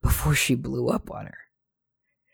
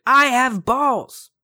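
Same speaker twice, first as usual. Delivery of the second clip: high, slow, louder put-on teacher yell; mock scream; 10 semitones higher, 5 dB louder, much slower than usual. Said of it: "at the wall and screamed".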